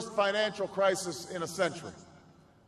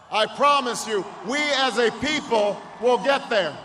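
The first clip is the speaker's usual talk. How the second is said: loud, building promise to the crowd; rousing shout; 6 dB louder than usual. Real, not acted. President-elect promising his supporters, loud rousing shout. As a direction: drive the promise home over the crowd. Victory rally, cheering crowd.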